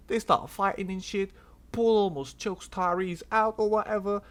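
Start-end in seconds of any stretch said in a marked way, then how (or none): none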